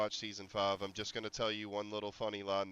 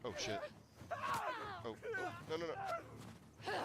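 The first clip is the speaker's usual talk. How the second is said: real panic as a zombie grabs you; panicked; 7 dB quieter than usual. Real, not acted. Streamer cornered by infected, panicked high yelps.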